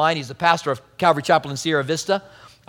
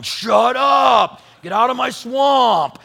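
Shrek voice; 5 semitones higher, much slower than usual. Retold or imitated. Pastor friend imitated yelling at the joking pair, Shrek shout. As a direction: roar it like an ogre defending his swamp. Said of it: "Shrek thing going on".

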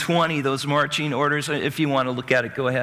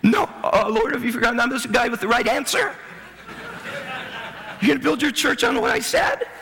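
high, indignant Peter, a bit fast; imitating Peter; 9 semitones higher than usual. Peter objecting to Jesus, higher and indignant. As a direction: play the proud disciple who had the answer. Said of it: "can you imagine Peter?".

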